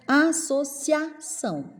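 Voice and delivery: "Associação" high and slow, cia in one beat; syllables apart but CIA together; joined vowels. Shown as a demonstration. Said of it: "Se a é junto".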